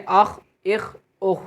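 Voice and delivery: اخ with a heavy, full-mouth خ and no echo; اخ said thick and heavy, held shut; full-mouthed kha. Shown as a demonstration. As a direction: fill the mouth on the خ of اخ. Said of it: "موٹی آواز سے".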